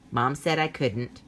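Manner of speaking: ordinary delivery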